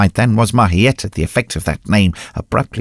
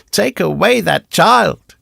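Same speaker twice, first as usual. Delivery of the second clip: high, drawn-out urgent cry; 9 semitones higher, 4 dB louder, slower than usual. A narrator's reading. Recluse pointing at the child, high urgent cry. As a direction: cry out the order, pitched well up. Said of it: "she cried".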